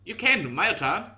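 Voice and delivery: high, loud urgent call; urgent shout. Acted. Hiyoshi blocking Mayo's way with a loud urgent cry. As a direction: cry out to stop her. Hiyoshi stops her suddenly.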